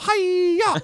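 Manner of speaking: high mock martial arts cry; karate yell. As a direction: shriek it like a movie fighter.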